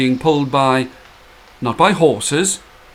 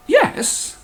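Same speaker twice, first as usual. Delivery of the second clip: startled yes. Acted. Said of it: "said the woman".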